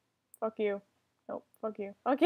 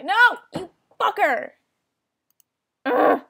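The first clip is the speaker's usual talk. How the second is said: loud higher curse; angry shout; 7 semitones higher, 12 dB louder than usual. Real, not acted. Gamer shouts at the player who got them. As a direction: spit it out furious. Eaten again.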